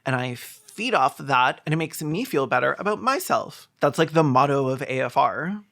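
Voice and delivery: ordinary delivery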